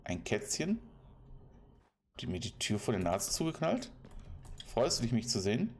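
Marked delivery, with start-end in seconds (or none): none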